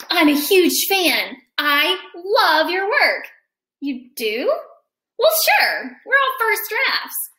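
lively picture-book read-aloud; reading to children. A narrator's reading. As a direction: read it to a class of kids.

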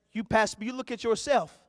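usual speaking voice

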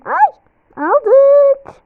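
high, loud happy squeal; excited squeal. Real, not acted. Grabbing her items, squealing excitedly. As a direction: squeal with delight. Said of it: "Çok heyecanlandım ya".

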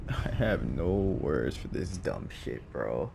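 low, soft, worn-down disbelief; quietly exasperated. Real, not acted. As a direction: mutter it low, almost speechless. Speechless after a frustrating loss.

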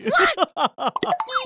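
gleeful cackle. Acted. Laughs at lost car.

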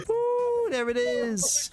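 high whooping celebration; excited cheer. Real, not acted. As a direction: cheer like you just won. Celebrating boss kill.

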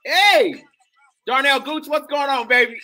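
high, loud greeting to a buddy; excited shout-out. Real, not acted. Calling out to a friend.

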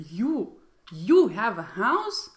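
incredulous question leaning hard on "you"; astonished "you", as if it cannot be true; disbelieving emphasis on you. Shown as a demonstration. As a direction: stress "you" in disbelief, lifting at house. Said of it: "so I'm like".

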